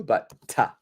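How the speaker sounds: "ta" with a short schwa vowel; relaxed reduction; reduced "ta". Shown as a demonstration. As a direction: say "to" as a weak "ta".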